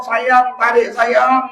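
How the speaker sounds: high pleading call from down the well; a hint of a wife calling from far below. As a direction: call sweetly for the rope to be pulled.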